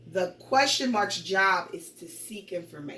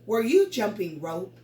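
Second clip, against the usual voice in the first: louder question, rising on ROPE; question intonation; 2 semitones higher, 5 dB louder than usual. Shown as a demonstration. Teacher repeating a question for pupils, rising intonation. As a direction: lift ROPE at the end to ask. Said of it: "That's a question".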